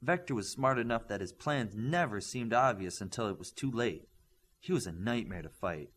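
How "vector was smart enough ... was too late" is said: usual speaking voice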